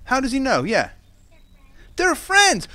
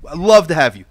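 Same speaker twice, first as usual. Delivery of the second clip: loud, enthusiastic welcoming reply; imagined God's eager welcome; 7 dB louder than usual. Acted. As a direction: answer loudly, like welcoming someone gladly. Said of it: "guess what God says".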